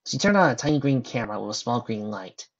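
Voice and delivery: ordinary delivery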